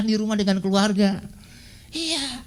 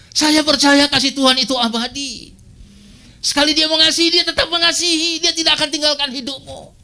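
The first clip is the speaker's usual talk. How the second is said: loud, raised preaching voice; forceful preaching; 5 semitones higher, 5 dB louder than usual. Real, not acted. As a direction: proclaim it to the congregation. Preacher proclaims God's lasting love. (khotbah lantang dan bersemangat)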